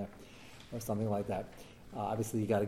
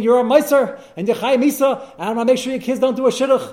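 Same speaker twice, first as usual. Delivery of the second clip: high, loud mock scream of accusation; retold screaming; 10 semitones higher, 12 dB louder than usual. Angry neighbour yelling at the man next door, mock screamed. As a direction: yell it like an outraged neighbour hurling threats. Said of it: "And he started screaming".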